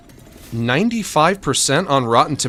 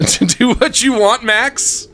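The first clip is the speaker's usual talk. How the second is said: laughing; 8 semitones higher, 4 dB louder than usual. Real, not acted. Laughing at viewer.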